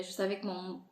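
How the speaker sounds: normal talking voice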